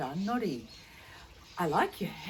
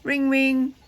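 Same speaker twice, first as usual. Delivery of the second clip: high, loud teasing character voice; mock-laughing tease; 8 semitones higher, 6 dB louder than usual. Sally Skittle teasing about the bicycle bell, high mock-amused voice. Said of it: "she was laughing and saying".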